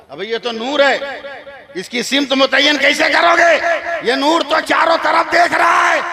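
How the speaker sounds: loud, high, mocking retort; scornful shout. (ऊँची आवाज़ में तिरस्कार भरा जवाब)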